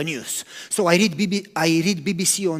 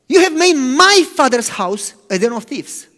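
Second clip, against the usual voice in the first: louder, raised rebuke; rebuking; 4 semitones higher, 7 dB louder than usual. Retold or imitated. Preacher voicing Jesus rebuking the traders, loudly. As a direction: call them out with force.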